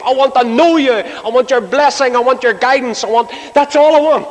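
high, insistent plea, building at the end; pleading Jacob. Retold or imitated. Jacob telling God what he wants, high urgent plea. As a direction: beg urgently, each want pitched high.

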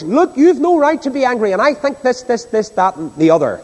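lightly voiced preachy scolding; moralizing preacher. Pastor acting out a counsellor scolding an angry person, mildly moralizing. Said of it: "That's the moralizing approach".

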